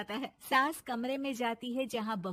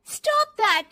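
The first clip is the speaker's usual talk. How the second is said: high, loud snap; angry shout; 11 semitones higher, 12 dB louder than usual. Acted. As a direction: shout it back in anger. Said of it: "जोर से गुस्से से बोल देती". (ज़ोर से गुस्से में चीख़)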